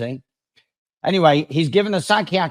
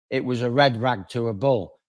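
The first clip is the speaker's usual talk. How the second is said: usual speaking voice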